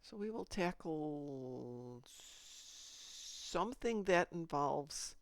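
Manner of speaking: ordinary talk, nothing marked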